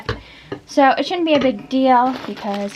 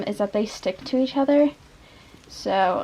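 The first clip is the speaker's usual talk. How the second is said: ordinary delivery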